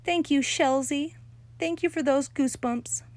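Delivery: high, loud, syrupy delight; a pleased girl praising her admirer; delighted gushing. A narrator's reading. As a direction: gush over him. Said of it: "Lucille's face beamed very happy".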